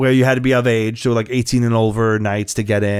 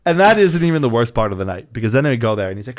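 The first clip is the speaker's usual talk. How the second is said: usual speaking voice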